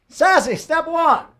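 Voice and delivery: high, loud, slow sassy call; sassy announcer. Pretend. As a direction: strut the words out with sass. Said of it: "The sassy way".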